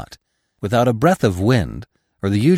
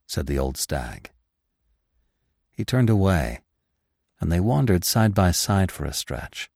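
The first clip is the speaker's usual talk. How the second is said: ordinary delivery, nothing marked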